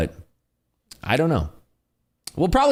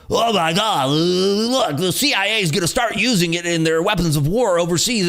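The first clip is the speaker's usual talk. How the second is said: high, excited mock hype voice; mocking crypto fanatic; 9 semitones higher than usual. Streamer mocking XRP fans, high mock excitement. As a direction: ham up a gullible crypto believer breathlessly.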